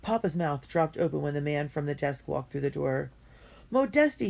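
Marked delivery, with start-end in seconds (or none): 3.7-4.3 high, loud cry of recognition; Papa greeting an old friend from Saint Petersburg, delighted; joyful cry. Acted. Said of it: "he cried".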